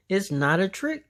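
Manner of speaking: urgent pleading. A narrator's reading. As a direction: beg her to believe you. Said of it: "Wilson pleaded".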